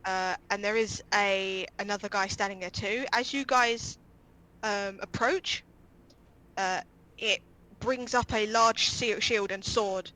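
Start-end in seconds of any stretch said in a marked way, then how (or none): none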